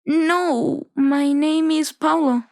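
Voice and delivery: put-on embarrassed boy telling his name; shy boy. Boy answers old lady.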